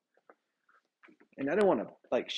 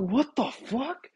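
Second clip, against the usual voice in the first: slow, higher-pitched retold shock; alarmed disbelief; 6 semitones higher, much slower than usual. Man waking to his girlfriend choking him, alarmed. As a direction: act out stunned disbelief. Retelling being choked in sleep.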